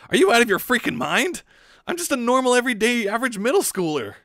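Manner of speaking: high, loud frantic denial; shrill panic fading by the end; panicked protest. Acted. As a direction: protest in a panic that you're just normal. Shinji panicking about being chosen.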